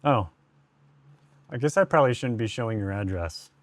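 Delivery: ordinary talk, nothing marked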